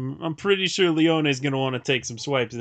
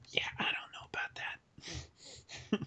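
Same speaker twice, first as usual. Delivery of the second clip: whispering; 14 dB quieter, slower than usual. Real, not acted. Muttered doubtful aside.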